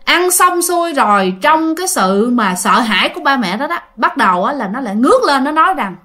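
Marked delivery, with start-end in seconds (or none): none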